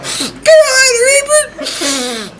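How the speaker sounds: very high, slow, loud crying wail; wailing sob. Retold or imitated. Correcting how he told the principal, a slow high sobbing wail. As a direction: wail the name out through tears and laughter. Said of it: "It was more like".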